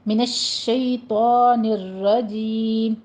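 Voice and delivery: الشَّيْطَانِ with a spreading sh and a heavy ta; slow recitation of الشَّيْطَانِ الرَّجِيمِ; slow model with a full sh; tajweed pronunciation. Shown as a demonstration. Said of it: "تفشی پیدا ہوگی".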